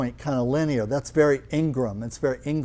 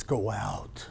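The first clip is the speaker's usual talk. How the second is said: body's voice; 6 semitones lower, 8 dB quieter than usual. Retold or imitated. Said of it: "but their body says".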